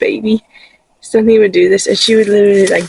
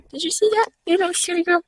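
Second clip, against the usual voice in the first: hushed, high-pitched affection; fond pet talk; 8 semitones higher, 9 dB quieter, faster than usual. Real, not acted. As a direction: coo quietly to a silly little animal. Cooing to her pet sheep.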